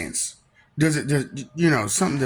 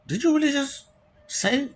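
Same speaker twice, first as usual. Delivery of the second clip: mock disbelief; 11 semitones higher than usual. Incredulous pitched-up disbelief.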